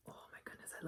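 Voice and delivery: awed whisper. Real, not acted. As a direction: breathe it out in awe. Savoring food, hushed awe.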